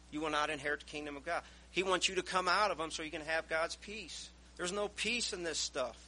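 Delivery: ordinary talk, nothing marked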